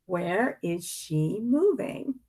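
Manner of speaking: plain reading voice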